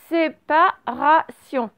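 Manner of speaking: slow dictation, each syllable separated. Shown as a demonstration. Teacher dictates first word to pupils, syllable by syllable. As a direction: dictate it slowly in detached syllables. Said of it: "On fait syllabe par syllabe".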